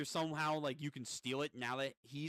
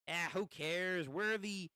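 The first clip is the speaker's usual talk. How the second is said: gremlin voice climbing higher; gremlin demon, dismissive; 5 semitones higher, slower than usual. Acted. Demon arguing with the witch, in indignant gremlin voice. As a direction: brush it off, rising in indignation.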